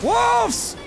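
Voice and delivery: high, loud warning cry; startled gamer shout; alarmed shout. Real, not acted. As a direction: yell it as the wolves appear. Wolves attack.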